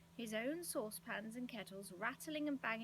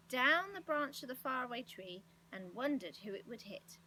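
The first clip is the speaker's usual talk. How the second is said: plain reading voice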